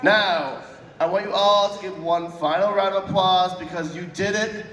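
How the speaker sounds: normal talking voice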